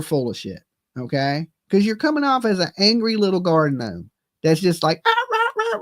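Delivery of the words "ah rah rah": very high yapping "Ah, rah, rah"; mock chihuahua bark. Mocking an angry little man, high yappy "rah, rah". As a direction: yap like a tiny angry dog. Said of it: "barking like a fucking chihuahua".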